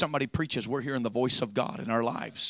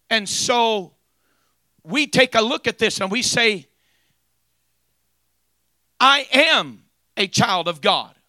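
loud, high fiery sermon; preaching at full force; 8 semitones higher, 12 dB louder than usual. Acted. Preacher rousing the church, loud and fiery. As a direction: drive it home to the congregation.

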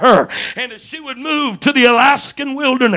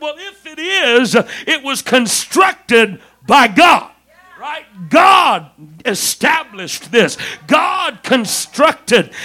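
rising to a shout, slowing on God; preacher declaring God built marriage, loud and slow; preaching; 4 dB louder than usual. Acted. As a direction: hammer the point home from the pulpit. Preacher building to shouted point.

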